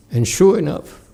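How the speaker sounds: usual speaking voice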